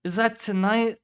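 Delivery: high-pitched groan of dismay. A narrator's reading. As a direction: groan as you remember too late. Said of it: "Adams groaned".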